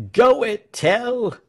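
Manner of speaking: high, loud cry of encouragement; excited high-pitched shout; crowd cheering. A narrator's reading. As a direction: egg him on from the crowd. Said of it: "they cried".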